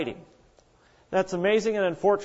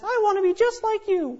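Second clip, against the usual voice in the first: much higher, lightly mocked young girl; mock young lady idolizing the old woman; admiring young lady. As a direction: gush like a starry-eyed young fan.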